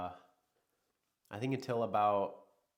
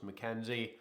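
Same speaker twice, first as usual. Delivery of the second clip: ordinary delivery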